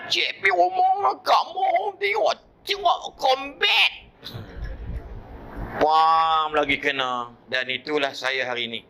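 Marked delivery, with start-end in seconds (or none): 0.1-3.9 much higher, pleading then shouting; boy's answer. Retold or imitated. Boy admitting to grandmother he watched Combat, high and shouted. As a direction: blurt the confession like a scared kid.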